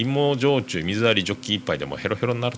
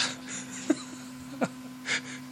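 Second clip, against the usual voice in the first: high squeaky laugh; 10 dB quieter than usual. Real, not acted. Laughing at the listener's letter.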